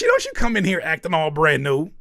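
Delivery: ordinary talk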